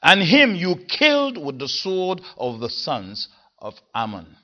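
front-loaded emphasis on "him"; stress on him. Shown as a demonstration. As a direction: drive the stress onto "him". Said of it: "the word at the beginning is always the one emphasized".

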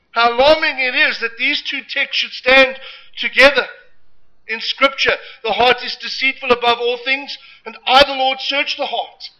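fervent preacher building loud; preaching. Acted. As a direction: preach it out with force. Preaching at full force.

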